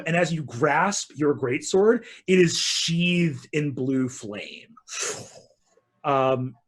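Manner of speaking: usual speaking voice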